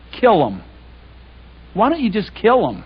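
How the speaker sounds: exasperated, pushing the point home; mock frustrated demand. Preacher voicing Jeremiah's frustration to God, exasperated. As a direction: voice Jeremiah's fed-up plea to strike them down. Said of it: "notice his frustration".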